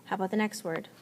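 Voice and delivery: ordinary talk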